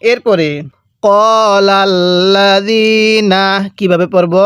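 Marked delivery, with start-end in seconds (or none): 1.0-3.4 ক্বালাল্লাযীনা very slow, long vowels held; teacher's measured tajweed model of ক্বালাল্লাযীনা; elongated Quranic recitation. Shown as a demonstration. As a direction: stretch the "zee" in ক্বালাল্লাযীনা. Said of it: "জালের মধ্যে যে এক আলিফ টান হবে".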